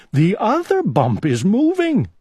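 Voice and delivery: sudden alarm at something under the covers; a mild startled exclaim; alarmed cry. A narrator's reading. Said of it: "cried Owl".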